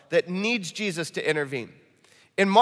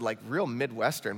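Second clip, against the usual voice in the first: ordinary talk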